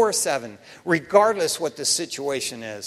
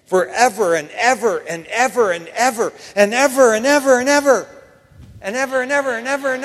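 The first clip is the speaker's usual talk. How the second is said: each ever pushed louder and higher, the last run faster; building repetition; 4 semitones higher, 7 dB louder than usual. Real, not acted. Preacher stressing eternity to his men, rising ever louder.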